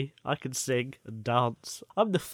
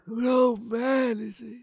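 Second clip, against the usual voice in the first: put-on slow, higher old man croak; 8 semitones higher, slower than usual. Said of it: "No, not like that".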